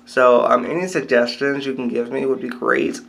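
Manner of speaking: usual speaking voice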